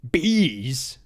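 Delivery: lightly voiced celebrity impression; a quick one-word celebrity impression; Will Arnett. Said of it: "That's my Will Arnett impression".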